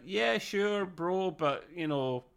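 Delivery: half-hearted, softly imitated agreement; reluctant. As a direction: go along with it reluctantly, imitating him. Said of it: "he's a bit reluctant to high five".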